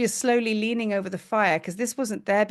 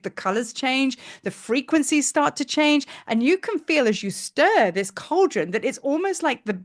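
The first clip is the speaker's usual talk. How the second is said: normal talking voice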